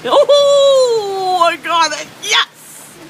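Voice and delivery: shrieking with joy in the storm; excited. Real, not acted. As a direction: yell it out, thrilled. Storm chaser thrilled in the rain.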